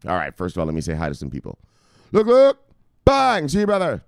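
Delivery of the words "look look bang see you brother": loud, high, punchy catchphrase yell; hype shout. Pretend. Streamer hyping his viewers with a loud high shout. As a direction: bark it out like a hype man.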